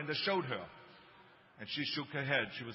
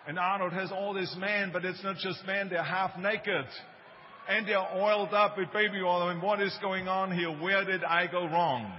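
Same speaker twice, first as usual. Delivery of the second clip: raised, higher voice of a distraught mother; exasperated mother; 4 semitones higher, 6 dB louder than usual. Retold or imitated. Distraught mother complains to the doctor about her son's posters, exasperated.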